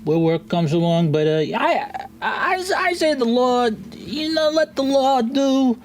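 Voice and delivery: high, rambling gnome voice; high-pitched, hesitant little merchant; Pimley. Acted. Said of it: "You see, Pimley says".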